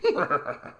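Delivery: loud chuckling horse laugh; a hearty snort. Acted.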